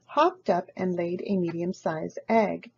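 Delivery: usual speaking voice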